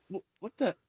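high-pitched, incredulous protest, retold; exasperated bafflement. Viewer retelling his reaction to a goblin in Seinfeld, exasperated bafflement. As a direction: act out rising disbelief, stammering at the start.

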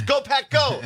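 high, shouted imitation of a fan chant; retold cheer at full pitch; loud fan cheer. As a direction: belt out the team cheer in mock excitement.

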